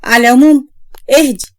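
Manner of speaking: plain reading voice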